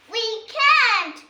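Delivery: high, loud protest; loud high despairing cry. Acted. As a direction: cry it out in alarm. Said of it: "cries Holly".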